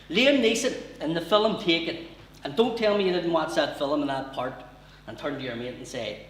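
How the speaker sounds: usual speaking voice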